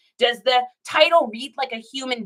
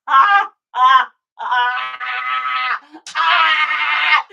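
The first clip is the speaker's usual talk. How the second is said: loud laugh-cry; 6 dB louder, much slower than usual. Real, not acted. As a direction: lose it in a laughing fit. Laughing fit.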